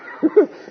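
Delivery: breathy, high squeal of laughter. Real, not acted. Picturing the skeptic's face, high squealing laugh. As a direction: let a squeal of laughter escape.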